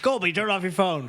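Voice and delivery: put-on loud, high gruff old man; gruff harrumph. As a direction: bark it like a cranky grandpa. Voicing old man telling off.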